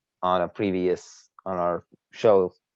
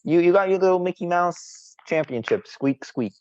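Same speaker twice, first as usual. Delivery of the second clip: high-pitched needling jeer; belittling, squeaky-high razzing; mocking taunt; 11 semitones higher than usual. Real, not acted. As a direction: tease your rival in a high voice. Taunting rival's small championship.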